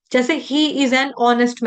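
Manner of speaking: ordinary talk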